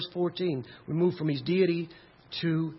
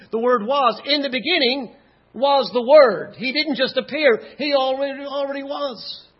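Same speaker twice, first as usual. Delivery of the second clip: raised, insistent pulpit voice; emphatic preaching; 6 semitones higher, 7 dB louder than usual. Acted. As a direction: proclaim it loudly to the congregation. Preaches at full force.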